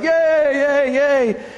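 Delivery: preacher voicing the crowd cheering Jesus, high and bright.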